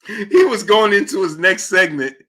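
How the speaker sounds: high, laughter-strained retelling; cracking up. Real, not acted. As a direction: try to tell it through laughter.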